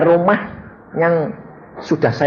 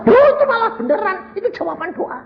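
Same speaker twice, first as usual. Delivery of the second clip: emphatic retort; faster than usual. Acted. Pastor answers the complainer about prayer, high excited retort. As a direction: burst out high, then drop the volume.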